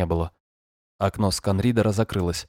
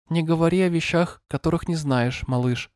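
gentle but monotone synthetic voice, higher; screen reader voice; 8 semitones higher than usual. Acted. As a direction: read it like a calm machine voice. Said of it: "звучал ласково, но монотонно".

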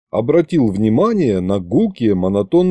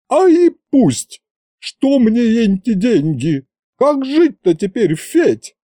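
put-on wailing old woman; 11 semitones higher, slower than usual. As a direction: throw up your hands and wail. Yaguta laments to Fedya.

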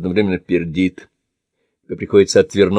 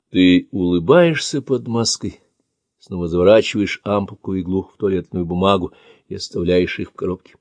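ordinary delivery

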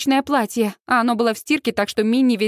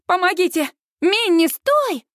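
high-pitched panicky calling; alarmed shout; 7 semitones higher than usual. A narrator's reading. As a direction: call out in alarm, stretching the last word. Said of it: "кричит Сьюз".

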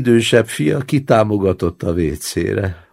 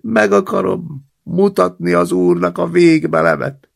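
babbling; 10 semitones higher, 5 dB louder than usual. Acted. Old man stammers at his grandson to lift him, high and loud.

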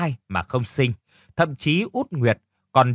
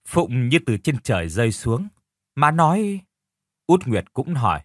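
plain reading voice

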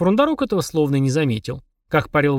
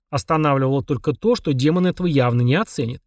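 ordinary delivery, nothing marked

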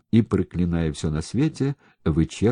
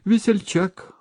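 high-pitched sarcastic jab; sharply raised pitch, grudging; grumbling; 4 dB louder than usual. A narrator's reading. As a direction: grumble it as a mocking retort. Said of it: "пробурчал Моралов".

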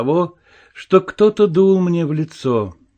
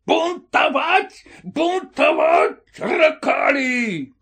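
high, slow, strained barking; barking shout; 10 semitones higher, much slower than usual. A narrator's reading. Officer bent to spring at mutinous soldiers, barking shout. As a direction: bark each word out in rage. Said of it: "каким-то новым лающим голосом закричал".

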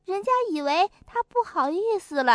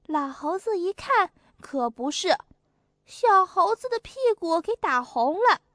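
ordinary delivery

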